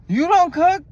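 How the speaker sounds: high, loud incredulous you don't cook; shocked question. Retold or imitated. Retelling the driver's surprise to viewers, mock disbelief. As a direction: repeat his shock, hammed up. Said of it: "That's what I said".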